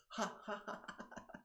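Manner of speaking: breathy ha fading to a whispered laugh; ha turning into laughter. Shown as a demonstration. Teacher showing how the exhale becomes laughter, fading to a whisper. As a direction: let the breath tip into a laugh. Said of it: "that sound is gonna evolve into laughter".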